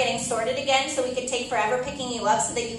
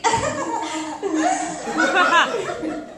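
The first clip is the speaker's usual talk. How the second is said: hearty laugh; 8 semitones higher, 4 dB louder than usual. Acted. Big shared laugh.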